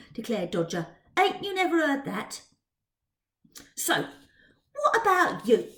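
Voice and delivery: cheeky and coaxing; Dodger's cockney voice. Acted.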